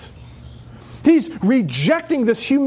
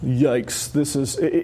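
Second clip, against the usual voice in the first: ordinary talk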